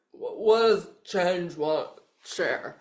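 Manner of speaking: slow, loud mock of a fumbled question; mock your own muddled words, breaking into a laugh; self-mocking garble. Reader teases herself for not speaking English, self-mocking garble. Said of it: "Like, speak English, me".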